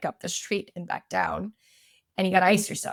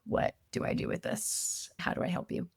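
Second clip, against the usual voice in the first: softer, breathy husband voice; hushed husband; 7 dB quieter than usual. Retold or imitated. Husband unsure how to help his weeping wife, hushed. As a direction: whisper it like a helpless husband. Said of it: "he was kind of like".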